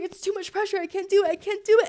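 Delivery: high, mounting panicked protest; acted panic. Re-enacts her panic attack.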